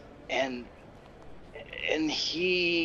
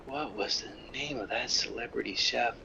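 whispered aside; 6 dB quieter than usual. Real, not acted. Host whispering to himself while trying to recall the chef, whispered. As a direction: whisper it under your breath as you search for a name.

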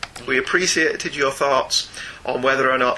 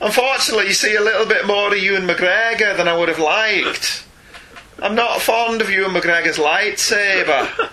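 put-on funny Scots accent; 2 semitones higher than usual. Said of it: "in a funny Scots accent".

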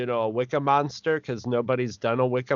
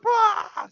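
startled yell. Retold or imitated. Retold character's startled yell.

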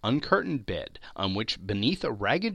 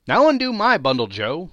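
higher, louder pushy woman; brash charwoman; 7 semitones higher, 8 dB louder than usual. A narrator's reading. Charwoman ordering Joe about, high and loud.

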